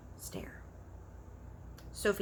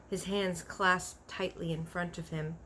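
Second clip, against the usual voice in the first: ordinary delivery, nothing marked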